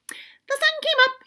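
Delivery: high chirpy squirrel voice; high squirrel chirp. Acted. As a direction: chirp it happily. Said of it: "he chirped".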